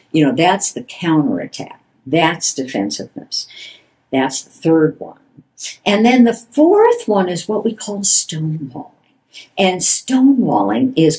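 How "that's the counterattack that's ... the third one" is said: ordinary talk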